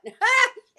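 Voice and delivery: real laugh, loud and high. Real, not acted. Teller cracking up telling friends her story, loud laughing.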